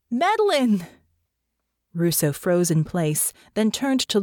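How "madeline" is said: high, loud call of her first name; louder shout. A narrator's reading. Natasha calling Russo by first name, louder shout.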